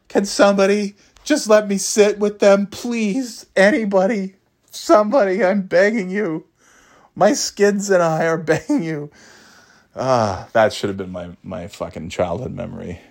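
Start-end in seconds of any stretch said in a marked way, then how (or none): 0.1-9.1 put-on much higher, whimpering little-kid plea; whiny pleading kid. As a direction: beg like a desperate schoolboy. Kid pleading for a bus seat.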